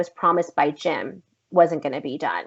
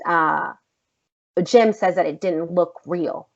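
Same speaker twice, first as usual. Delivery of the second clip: normal talking voice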